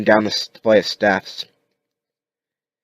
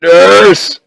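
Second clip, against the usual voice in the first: a loud, high desperate call; shouting for a nurse; 12 semitones higher, 15 dB louder than usual. Acted. Rick calling out for a nurse, loud and high. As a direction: yell down the empty ward for help. Rick wakes alone in hospital.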